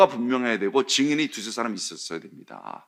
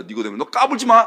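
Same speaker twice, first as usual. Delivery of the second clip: higher, louder angry scolding; angry rebuke; 7 semitones higher, 6 dB louder than usual. Retold or imitated. Council shouting down Nicodemus, angry rebuke. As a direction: put him in his place.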